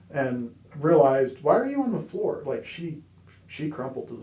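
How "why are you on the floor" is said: high, louder re-enacted surprise; retold baffled question. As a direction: ask it in mock astonishment, pitch leaping up. Acts out own puzzled question to Devin.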